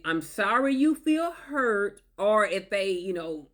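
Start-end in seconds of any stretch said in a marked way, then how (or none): 0.0-2.0 very slow, condescending sorry; mock sympathy, dragging it out; fake apology. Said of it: "which is not an apology".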